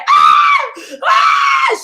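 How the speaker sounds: high piercing mock scream; retold screaming. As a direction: shriek out like a woman in shock. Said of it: "She on the phone screaming and crying".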